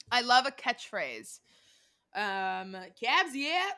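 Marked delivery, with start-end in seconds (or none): 3.0-3.7 high loud party-guy call; Pauly impression. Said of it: "That's literally how he says it".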